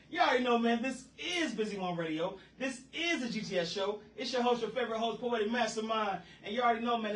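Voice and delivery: high, loud radio intro; radio host hype. Acted. Host introducing his radio show, hyped announcer voice.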